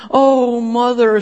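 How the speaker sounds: high, loud anguished cry; a daughter bursting into tears on the phone; bitter tears. A narrator's reading. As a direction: wail it out to her mother. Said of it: "breaking into bitter tears".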